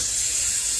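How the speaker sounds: whispered hiss; snake hiss. Shown as a demonstration. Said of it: "And everybody goes".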